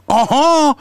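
squeaky high-pitched insistence; high mock neighbor voice; high sassy uh-huh. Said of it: "And she said".

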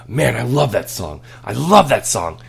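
imitating a pumped-up kid; excited kid voice. Son quoted after song.

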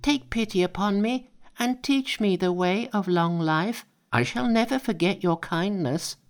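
high, pleading monkey voice. A narrator's reading.

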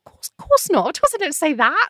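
high-pitched, appalled denial; retold indignant denial; protesting. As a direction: reject the very idea, pitch up. Retelling her protest at the suggestion.

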